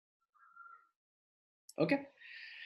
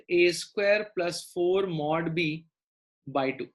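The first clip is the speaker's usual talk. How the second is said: slow dictation; 3 semitones lower, slower than usual. Real, not acted. Teacher dictating formula slowly.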